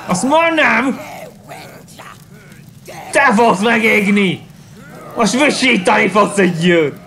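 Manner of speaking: hammed-up villain taunting; mock taunt.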